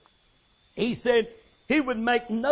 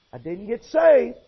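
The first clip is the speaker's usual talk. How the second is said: usual speaking voice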